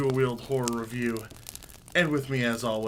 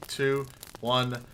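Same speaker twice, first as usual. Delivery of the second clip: ordinary talk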